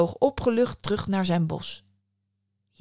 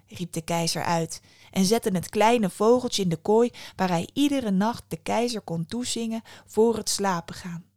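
plain reading voice

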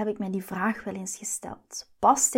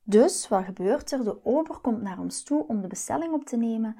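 ordinary talk, nothing marked

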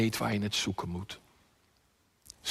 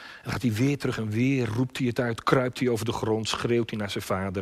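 usual speaking voice

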